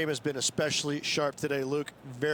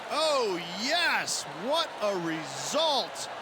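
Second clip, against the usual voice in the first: excited; 6 semitones higher, much slower than usual. Acted. Great shot in golf broadcast.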